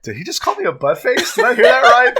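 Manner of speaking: high, loud mock-incredulous questions; imitated disbelief. Host retelling the streamer's reaction to the insult, mock disbelief. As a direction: act out astonishment, half laughing. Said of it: "And I heard you go".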